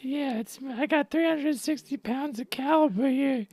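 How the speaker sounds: high pitched cartoon character. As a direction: push the voice way up for laughs. Goofy high voices bit.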